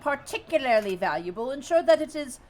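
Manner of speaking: high, loud, haughty dismissal; haughty goblin chief. Acted. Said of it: "Yek says".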